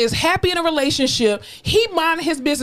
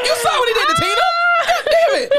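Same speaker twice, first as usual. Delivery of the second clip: laughing shout; faster than usual. Pretend. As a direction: yell it while cracking up. Laughing mock outrage.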